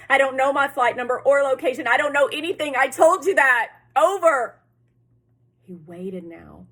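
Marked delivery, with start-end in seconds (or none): 0.1-4.6 loud, high, exasperated outburst; desperate frustration. A narrator's reading. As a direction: shout back at the operator in frustration. Boy frustrated with operator's questions.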